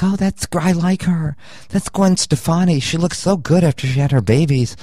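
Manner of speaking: put-on high, gushing girlfriend. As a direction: play the excited girlfriend, high. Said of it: "the girl's like".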